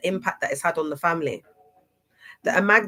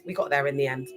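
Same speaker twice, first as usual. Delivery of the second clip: ordinary delivery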